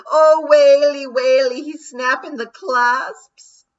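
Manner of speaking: high, loud, slow terrified groan; Daft Woolly groaning. A narrator's reading. Daft Woolly watching the lawyer's clasps, loud high groaning dread. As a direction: moan in dread. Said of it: "groaned Daft Woolly".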